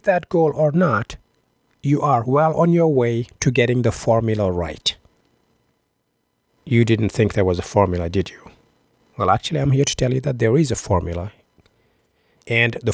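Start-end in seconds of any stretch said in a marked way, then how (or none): none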